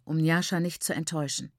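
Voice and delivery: plain reading voice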